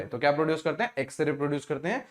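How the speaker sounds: ordinary talk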